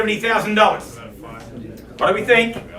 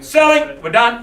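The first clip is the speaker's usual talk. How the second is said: loud, high "Selling"; auctioneer's high, loud selling call; 5 semitones higher, 4 dB louder than usual. Real, not acted. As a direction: announce the sale to the whole room. Auctioneer calling out to bidders.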